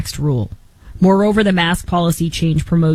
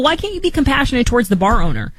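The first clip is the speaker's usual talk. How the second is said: normal talking voice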